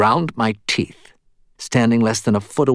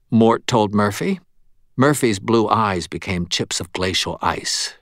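ordinary delivery

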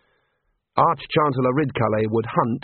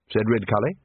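plain reading voice

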